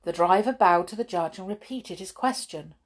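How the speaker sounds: ordinary delivery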